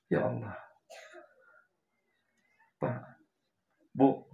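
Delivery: breathy whisper to God; whispered prayer. Real, not acted. Preacher whispering to God before the crowd, overcome.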